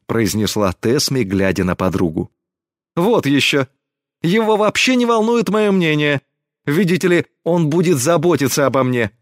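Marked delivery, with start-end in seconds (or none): 3.0-9.1 high-pitched, huffy mimicking; mocking mimicry. A narrator's reading. Girl mimicking a Gorg to her friend, mocking. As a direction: scoff and parrot him, pitched up. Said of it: "передразнила Гресни Горга".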